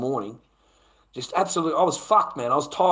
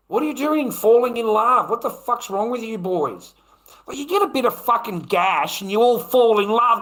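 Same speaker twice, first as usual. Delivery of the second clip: ranting; 7 semitones higher, 4 dB louder than usual. Real, not acted. Ranting at boys about love.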